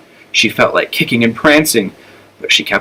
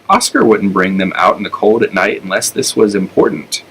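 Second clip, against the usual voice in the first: usual speaking voice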